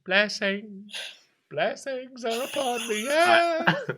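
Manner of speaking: high, slow, drawn-out chant; loony blessing, trailing on; dazed zealot old man. Acted. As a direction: keep crooning it dreamily. Old man rolls around going on.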